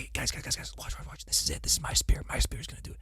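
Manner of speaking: excited stage whisper calling the guys to watch. Acted. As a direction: hush everyone, then boast under your breath. Voicing King V showing off.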